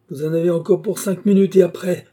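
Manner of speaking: high, loud countdown of doom; menacing proclamation. A narrator's reading. Mad worker announces death to trapped passengers, fervent menace. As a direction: pronounce their fate fervently.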